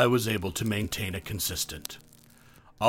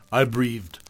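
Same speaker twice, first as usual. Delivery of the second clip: plain reading voice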